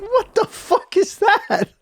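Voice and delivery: high, slow, drawn-out disbelieving question; incredulous disbelief. Retold or imitated. Man balking at his trainer's exercise, slow high disbelief. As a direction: stretch it out in outraged disbelief.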